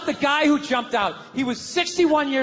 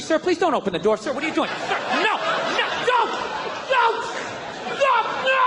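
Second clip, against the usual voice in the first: rising panic, pleading higher and higher; panicking pilot; 8 semitones higher than usual. Acted. Tour pilot begging a passenger not to jump, panicking. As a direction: beg him not to open the door.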